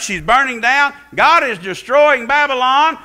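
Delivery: ordinary delivery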